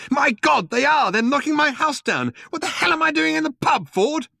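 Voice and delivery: high, frantic panic; rising alarm at the sight. Acted. Arthur seeing his house demolished, frantic panic.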